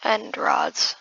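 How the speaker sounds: usual speaking voice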